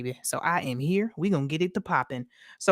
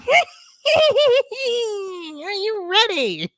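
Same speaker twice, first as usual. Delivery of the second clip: witch voice; 5 dB louder than usual. Acted. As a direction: stretch it out like a wicked witch. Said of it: "waiting for the witch to roll from behind the curtain".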